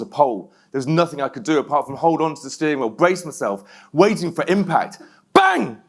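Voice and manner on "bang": crash bang. Retold or imitated. Retelling the crash impact.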